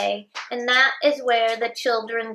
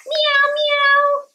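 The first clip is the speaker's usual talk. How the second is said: high, loud meowing; playful cat meow; 13 semitones higher, 4 dB louder than usual. Acted. Cat sound in animal story.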